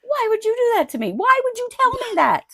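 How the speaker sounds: high-pitched, indignant imitation; mock angry sister. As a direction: wail it like an outraged sister. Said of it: "her sister's so fucking mad at her".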